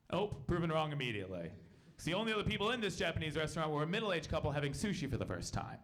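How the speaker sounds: normal talking voice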